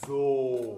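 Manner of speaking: ゾー held long and full; long vowel. Shown as a demonstration. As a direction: stretch ゾー out long. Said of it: "ゾーは長めに".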